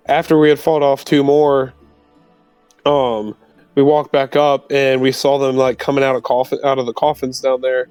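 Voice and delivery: ordinary talk, nothing marked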